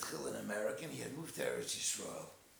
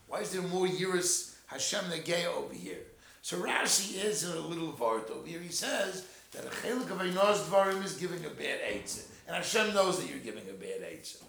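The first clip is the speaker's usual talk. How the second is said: louder, driving sermon voice; forceful preaching; 4 semitones higher, 9 dB louder than usual. Acted. Preacher pressing the point to the listeners, forceful.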